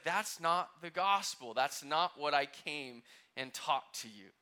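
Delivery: slow, loud, every word weighed; firm slow declaration of truth; emphatic rebuttal. Retold or imitated. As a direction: plant your feet and correct them.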